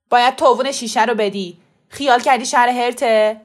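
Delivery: loud, forceful demand; angry shouting. A narrator's reading. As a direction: shout them down in a quarrel. Shopkeeper shouting back in quarrel. (داد و بیداد طلبکارانه)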